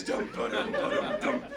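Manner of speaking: toneless drummed-out hoof rhythm; galloping hoofbeats. Acted. Storyteller takes the horses up the wall, galloping hoofbeats. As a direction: drum out a gallop with the mouth.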